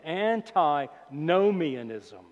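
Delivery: very slow, stretched-out "antinomianism"; real slowly. Shown as a demonstration. Preacher teaching a big word, saying "antinomianism" real slowly. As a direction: draw "antinomianism" out part by part for learners. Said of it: "I'll say it again real slowly".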